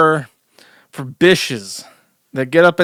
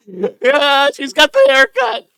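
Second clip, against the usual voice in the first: high-pitched, through suppressed laughter; helplessly amused, voice pushed high; cracking up; 11 semitones higher, 4 dB louder, slower than usual. Real, not acted. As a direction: squeal it out while losing it laughing. Cracking up at the clip.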